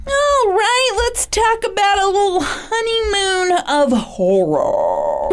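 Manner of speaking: high, slow mock spooky announcer; hammed-up horror host intro. Announcing the next story.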